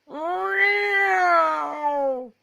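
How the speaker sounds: high drawn-out cat meow; cat yowl. A narrator's reading. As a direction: wail it like a cat up a tree. Cat's terrible yowl in story.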